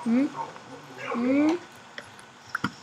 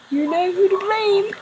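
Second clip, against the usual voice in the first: higher, louder mock blame; louder, higher teasing blame; mock accusing; 9 semitones higher, 9 dB louder than usual. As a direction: point the finger playfully, up high. Mock blaming Portal.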